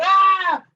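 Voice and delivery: high, loud mock shriek; piercingly high acted yell of fright; imitated scream. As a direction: scream as if grabbed by the throat. Retelling mother grabbed by throat.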